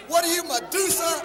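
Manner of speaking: ordinary delivery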